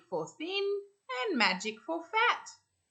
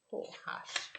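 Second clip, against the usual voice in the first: soft unvoiced "poor Hush"; whispered sympathy; 10 dB quieter than usual. A narrator's reading. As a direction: breathe it with pity. Narrator pities the character.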